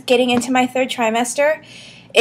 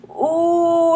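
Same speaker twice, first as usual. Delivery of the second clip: discomfort groan; 6 semitones higher than usual. Retold or imitated. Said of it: "I'll just be like".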